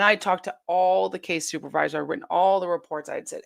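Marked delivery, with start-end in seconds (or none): none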